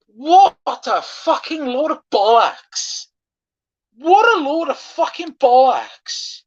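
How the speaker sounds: high, loud, slow furious shout; furious ranting. Real, not acted. Fan raging at the disallowed goal, furious slow shout. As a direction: rage at the decision, stretching the words.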